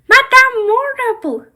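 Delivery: high, loud little greeting; high excited name call; high doll voice. Pretend. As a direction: squeal the name like an excited pupil. Doll character greets teacher.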